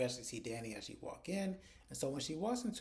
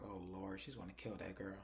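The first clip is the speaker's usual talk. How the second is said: low, soft aside in mock dread; hushed dread; 4 semitones lower, 7 dB quieter than usual. [name] foresees trouble for Andy, hushed mock dread. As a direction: drop it under your voice, acting worried. Retold dread after her threat.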